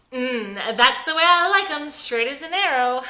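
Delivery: high, loud, giddy flirt; tipsy wife flirting with her sober husband, giddy. Acted. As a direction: tease him with drunken delight. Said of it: "Vicky is tipsy now".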